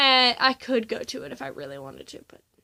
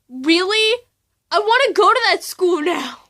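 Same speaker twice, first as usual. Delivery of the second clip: louder, eager gasp of excitement; excited; 6 semitones higher, 7 dB louder than usual. Retold or imitated. Teen thrilled to hear of an anime club, excited. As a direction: jump at the news, thrilled. Jaw-dropped reaction retold.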